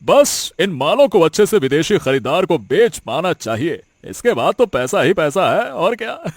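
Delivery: ordinary talk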